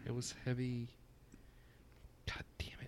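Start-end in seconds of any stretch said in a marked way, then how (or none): 2.3-2.9 whispered frustrated curse; annoyed whisper to himself. Real, not acted. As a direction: curse under your breath. Muttered frustration, can't recall.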